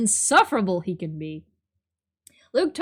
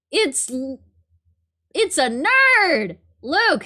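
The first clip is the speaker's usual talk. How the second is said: startled shout; 5 semitones higher, 6 dB louder, much slower than usual. Acted. Startled at hand on shoulder.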